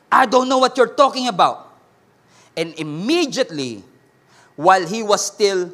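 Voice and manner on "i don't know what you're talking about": loud denial. Acted. Peter denying Jesus a third time.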